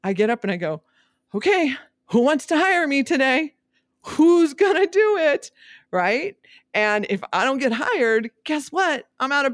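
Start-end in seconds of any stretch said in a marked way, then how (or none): 1.4-5.5 high, loud, eager calling out; eager call for hire. Acted. A realtor calling out to would-be clients, loud and eager. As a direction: call out like a hopeful hawker asking who will hire her.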